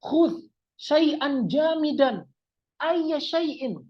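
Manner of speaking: high, slow, deliberate Arabic request; example request to friend. Shown as a demonstration. Speaking to a friend in a sample sentence, high deliberate request. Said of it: "saya ngomong sama teman saya".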